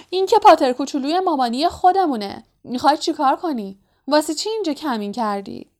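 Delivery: high-pitched teasing spirit voice; بد اونق. A narrator's reading. Troublesome spirit catches Harry lurking, high mocking taunt. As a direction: taunt in a high, sing-song imp voice. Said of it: "هر هر خندید و گفت".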